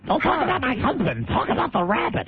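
high mocking whine of the caller; imitating Marion. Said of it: "She talks like Giuliani if he were a fat girl".

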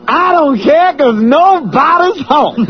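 high, slow stand-up holler; Chris Rock. Acted. Host riffing on being home alone, Chris Rock impression. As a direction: work the crowd like a shouting comedian. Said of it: "That's Chris Rock".

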